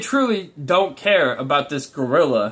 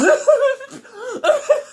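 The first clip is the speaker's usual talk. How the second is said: high mock laugh; 3 dB louder than usual. Party guest laughs at own joke.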